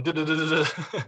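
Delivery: high babbled nonsense syllables; mock rapid Vietnamese. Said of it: "start going".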